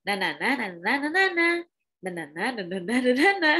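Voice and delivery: the same rising tune on "na"; hum-talk the samey lilt in nonsense syllables; repetitive intonation. Retold or imitated. Coach mocking TikToker's intonation, repeated sing-song na-na. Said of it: "gitu-gitu aja tuh intonasinya".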